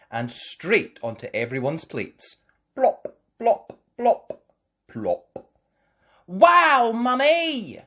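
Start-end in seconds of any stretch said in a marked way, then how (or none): 2.7-5.5 high bouncy plop sounds; playful plops. A narrator's reading. Sound words. 6.3-7.8 high, loud cheer; delighted shout. A narrator's reading.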